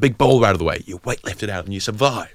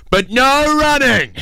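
high, loud, slow laughing call; mock shout; 5 dB louder, much slower than usual. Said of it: "they said".

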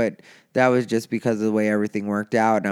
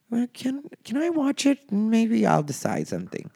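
high mock plea; 12 semitones higher than usual. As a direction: squeak it out like a meek ask. Small high pleading reply.

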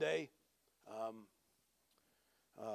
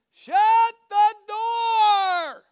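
long, high-pitched holler; neighbor's yell; 14 dB louder, much slower than usual. Retold or imitated. Woman yelling down the street at a stranger, loud drawn-out holler. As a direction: bellow it drawn out across a distance.

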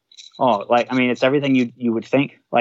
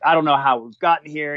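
mildly heated retold reprimand, pitched higher; retold scolding; 4 semitones higher than usual. Battalion chief chewing out his officer, mildly heated. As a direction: act out a boss's dressing-down, lightly. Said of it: "he starts chewing me".

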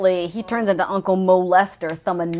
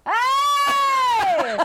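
delighted at the Uncle Philip joke, shouting and laughing. Real, not acted.

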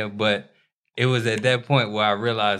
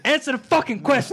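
much higher, louder demand; exasperated shout; 3 dB louder than usual. Real, not acted. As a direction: yell it at friends who dodge. Demanding an answer from friends.

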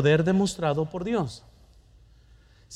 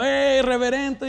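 mock heckling yell; 6 semitones higher than usual. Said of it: "Estaban gritando".

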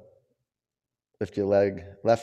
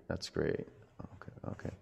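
whispering; 3 semitones lower, 14 dB quieter than usual. Real, not acted. Quiet aside to one student.

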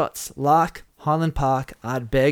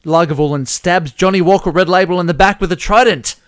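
loud, raised-pitch play-by-play; excited kill announcement; 4 semitones higher, 7 dB louder than usual. Real, not acted. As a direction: announce the attack with mounting excitement. Host announces the first kill.

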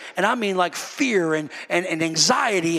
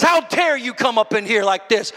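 preacher acting out a rebuke, rising in pitch and force; stern rebuke; 8 semitones higher, 5 dB louder than usual. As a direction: rebuke him, building to the accusation. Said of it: "He rebukes Herod".

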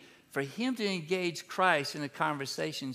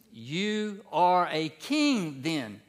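"You are a king then." said slowly as a flat statement, falling on "king"; declarative statement; much slower than usual. Shown as a demonstration. Preacher showing the NIV line as a statement. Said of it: "It's with an exclamation mark".

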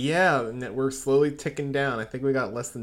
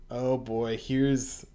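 ordinary delivery

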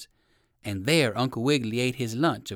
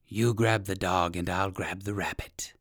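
low villainous fox voice; savage fox; 6 semitones lower, 4 dB quieter than usual. A narrator's reading. Biggest fox ordering his partner to attack, menacing. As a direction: give the order low, like a predator. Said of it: "called the biggest fox".

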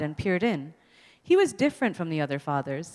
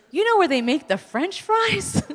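high animated question; 12 semitones higher, 3 dB louder than usual. Retold or imitated. Father storytelling.

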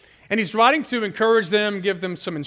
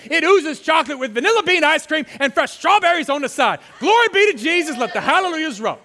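rapturous; 7 semitones higher than usual. Acted. Rapturous food description.

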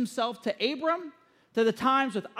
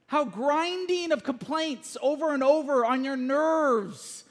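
ordinary talk

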